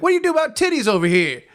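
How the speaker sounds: high, fast teasing imitation of a casual guy; mocking his friend. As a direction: ham up his buddy's blunt question, high and quick. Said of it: "and you're like".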